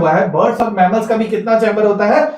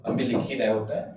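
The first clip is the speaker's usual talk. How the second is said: quiet, lower aside about the spelling error; 9 semitones lower, 12 dB quieter than usual. Real, not acted. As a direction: lower and much softer. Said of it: "aside about a spelling mistake".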